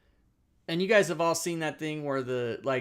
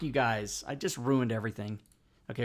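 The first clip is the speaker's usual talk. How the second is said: ordinary talk, nothing marked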